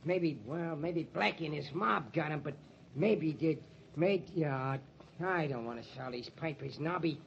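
low, soft, hesitant; subdued, troubled mumbling; downcast and unsure. Acted. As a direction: admit your doubts reluctantly. Newsboy drops his cry, troubled by rumour.

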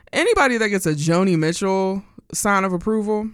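usual speaking voice

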